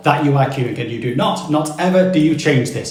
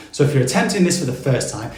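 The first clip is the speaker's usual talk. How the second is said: ordinary delivery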